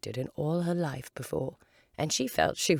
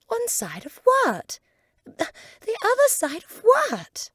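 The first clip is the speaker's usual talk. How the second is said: much higher, louder, slow puzzled voice; bewildered, asking; very high, baffled girl's voice; puzzled Alice; 9 dB louder, slower than usual. Acted.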